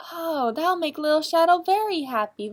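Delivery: ordinary delivery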